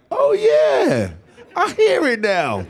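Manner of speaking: high, loud mock know-it-all fan; imitating sudden realisation. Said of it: "then they're like".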